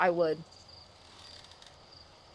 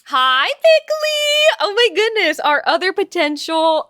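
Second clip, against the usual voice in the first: high, loud, slow squealed hello; excited greeting; 6 semitones higher, 7 dB louder, slower than usual. Real, not acted. Streamer greeting Bickley in chat, excited squeal.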